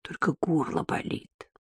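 very soft, husky near-whisper; hoarse. A narrator's reading. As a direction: rasp it quietly from a sore throat. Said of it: "хрипло ответила Маша".

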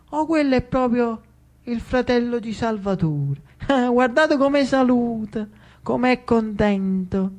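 a sly, sneering devil gossiping; a hint of a devil jeering; mocking devil. Devils mock Lucio greeting.

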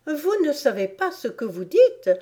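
high-voiced daring retort; bold interruption. A narrator's reading. Alice interrupts the furious queen, boldly. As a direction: cut in with bold defiance. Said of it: "interrompit Alice avec une audace".

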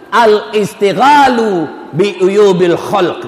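slow, measured Arabic hadith phrase; slow recitation. Acted. Preacher quoting the Prophet, slow solemn Arabic recitation. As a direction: lay out each Arabic word slowly.

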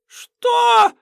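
piercing high outraged shriek; shrill shriek. A narrator's reading. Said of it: "Авалонка тут же вышла на ультразвук". (возмущённый визг)

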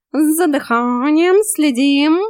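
high, loud, drawn-out reminder; stretched feline drill command; bossy cat coach. A narrator's reading. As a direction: call it out like a trainer. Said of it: "брякнул кот".

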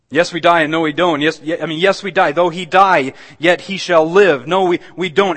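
loud, emphatic preacher's declamation; impassioned preaching. Real, not acted. Preacher explaining that believers die yet live, impassioned preaching. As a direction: hammer each clause home to the congregation.

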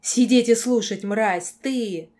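loud angry order; furious command. A narrator's reading. Girl forcing the lord to listen, furious command. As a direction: shout him into silence.

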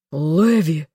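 restrained cry of horror; horrified roar. A narrator's reading. Man horrified as she steps into water, horrified roar. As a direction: call the name in alarm. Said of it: "в ужасе взревела Зазель".

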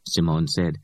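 ordinary delivery, nothing marked

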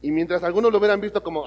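ordinary talk, nothing marked

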